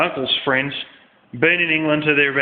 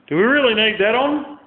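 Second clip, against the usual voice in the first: pitched high, a mock-innocent question; incredulous question; 9 semitones higher than usual. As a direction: ask it as if you can't believe it. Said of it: "and I said".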